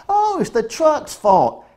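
high, slow sneering mockery; mock the liberal pundit. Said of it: "liberal T V shows talking about".

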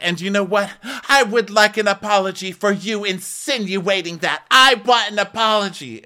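high-pitched, hammed-up outrage; mock indignant demand. Rinna demanding an apology from Garcelle, mock indignant.

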